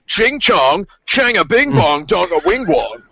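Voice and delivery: imitating bad fake Chinese; fake foreign language. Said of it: "he goes".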